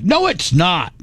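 high-pitched mock complaining; hammed-up petulant objection; retold complaint.

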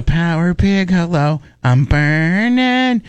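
drawn-out call, burning stretched; mock safe word cry. Host acting out the show's safe word, mild mock cry. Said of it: "that was our safe word".

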